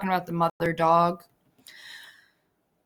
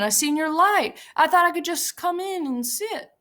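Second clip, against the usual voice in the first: higher, earnest and innocent; Lenny's simple voice; 7 semitones higher than usual. A narrator's reading. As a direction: keep explaining like a lonely child. Lenny's voice, childlike.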